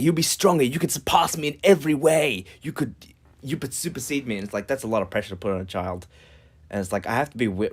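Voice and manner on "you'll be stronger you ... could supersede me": higher, louder heroic pep talk; inspiring speech. Acted. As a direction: fire up your heir with pride. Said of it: "I get excited".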